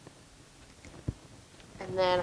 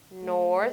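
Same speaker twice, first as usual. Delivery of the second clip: "North" held alone as the first beat; syllable by syllable. Shown as a demonstration. Teacher counting syllables with a pupil, "North" set apart. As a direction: stretch "North" and pause after it. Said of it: "Let's count the syllables".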